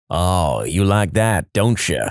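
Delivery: low, suggestive mock moan. Woman leaning close to Greg, low mock moan. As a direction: play at moaning it out, low. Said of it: "moans, and says".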